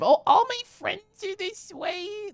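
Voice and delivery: very high, drawn-out coo; delighted squeal. Real, not acted. Streamer finding friends' messages in game, high delighted squeal. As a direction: squeal it slowly, high in pitch.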